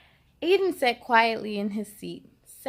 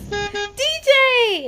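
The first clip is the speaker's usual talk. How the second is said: high, loud call across the bus; boy hailing his friend on the bus; 10 semitones higher, 10 dB louder than usual. A narrator's reading. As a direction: shout his friend's name to get his attention. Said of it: "Aiden yelled out".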